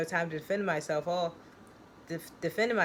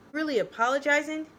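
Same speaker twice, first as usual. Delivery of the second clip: hysterical mockery; 9 semitones higher, 5 dB louder than usual. Joe hysterical, mocking.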